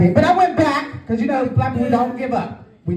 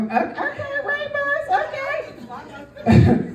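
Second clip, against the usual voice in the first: mock cheer. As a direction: squeal it up high like an excited fan.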